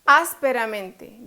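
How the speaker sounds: "Ásperamente" slow, loud on "Ás"; stress on first syllable. Shown as a demonstration. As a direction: punch the "Ás" of "Ásperamente". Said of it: "Lleva la fuerza". ("Ásperamente" con fuerza en "Ás")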